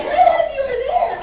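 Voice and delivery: overexcited high squeal; hyper excited cry. Retold or imitated. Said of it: "And I'm like".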